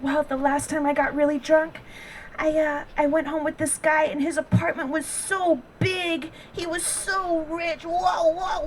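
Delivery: slow mock-girly gushing; put-on girly voice. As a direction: mimic a friend gushing in baby talk. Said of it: "she was just like".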